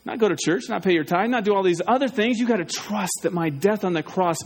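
normal talking voice

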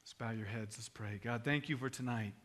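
soft, measured pastoral prayer. Real, not acted. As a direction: pray unhurriedly, lingering on phrases. Leads the congregation in prayer.

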